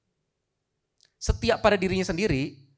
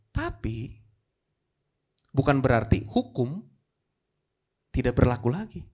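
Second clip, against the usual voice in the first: ordinary talk, nothing marked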